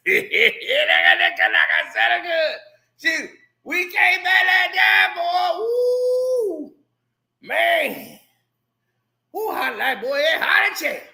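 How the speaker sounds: loud, high rambling holler; hollering old folks. Retold or imitated. Old homeboys catching up, loud holler. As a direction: holler with a sung ooh. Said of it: "that's how they talk".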